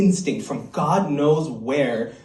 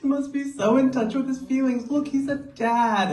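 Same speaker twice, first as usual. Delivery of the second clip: much higher, swooning mock gush; gushing smitten self; 10 semitones higher than usual. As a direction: play up a starry-eyed crush. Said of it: "I'm like".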